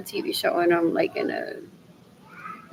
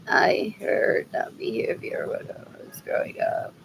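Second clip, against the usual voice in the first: whispered repeat of the line; 6 dB quieter than usual. Real, not acted. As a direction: breathe it out as a whisper.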